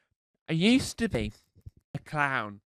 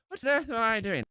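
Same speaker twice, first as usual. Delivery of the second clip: bewildered; 7 semitones higher, 4 dB louder than usual. Retold or imitated. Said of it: "And I'm standing here".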